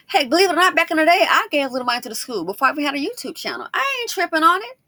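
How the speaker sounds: loud, high-pitched self-justification; defensive insistence. Real, not acted. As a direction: protest loudly that it doesn't bother you.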